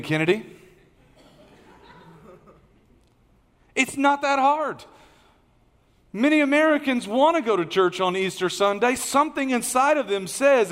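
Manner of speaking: high, loud, driving sermon push; rising to the point of the sermon; full-force preaching. Acted. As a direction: preach it hard to the whole room.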